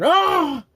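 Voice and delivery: loud, high-pitched movie scream; imitated scream. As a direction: mimic a character's sudden shriek. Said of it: "he just starts screaming".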